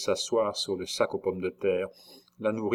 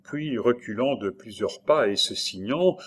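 ordinary delivery, nothing marked

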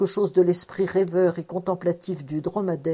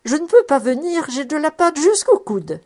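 high-pitched, raised voice; exclaiming old woman; 8 semitones higher, 5 dB louder than usual. A narrator's reading. Old cook kneading dough calls from the kitchen, exclaiming. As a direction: call out high from the next room. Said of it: "s'écria la bonne femme".

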